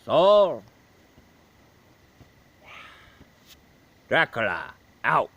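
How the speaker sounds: slow, put-on vampire count; Dracula voice. Dracula answering the reviewer, slow put-on count. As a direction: play the suave old Count, slow.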